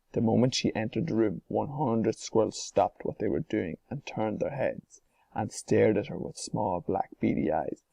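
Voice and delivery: plain reading voice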